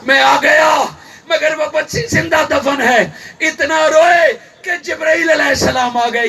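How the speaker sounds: forceful sermon storytelling; impassioned preaching. Acted. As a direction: preach it out line by line. Preacher retells at full force.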